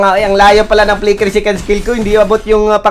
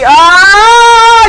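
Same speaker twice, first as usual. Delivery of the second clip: loud, high, rising scream; drawn-out mock death wail; long hammed-up scream; 14 semitones higher, 8 dB louder than usual. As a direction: hold a high "aah" at full volume. Long scream during game fight.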